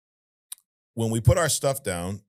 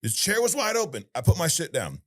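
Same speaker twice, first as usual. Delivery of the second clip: usual speaking voice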